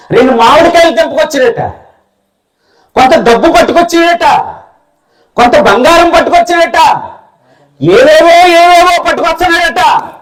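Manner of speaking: ordinary talk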